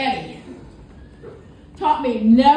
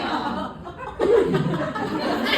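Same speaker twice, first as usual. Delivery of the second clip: incredulous laugh; 5 semitones higher than usual. Real, not acted. Laughs at back scratch request.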